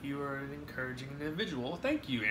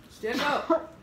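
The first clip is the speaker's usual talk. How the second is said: much higher and louder repeat of the order; urgent shout; 10 semitones higher, 5 dB louder than usual. Real, not acted. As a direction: shout it now, she must get up.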